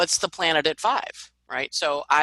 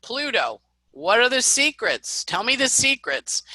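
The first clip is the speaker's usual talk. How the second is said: pointed, eager interrogation; demanding; 7 semitones higher than usual. Acted. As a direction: press for it, drawing out the question. Acting out probing for secrets.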